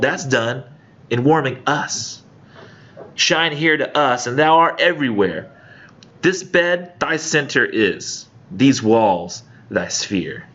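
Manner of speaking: dramatic verse reading; poem reading. Acted. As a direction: read it like a performed poem, with weight. Reads Donne poem aloud.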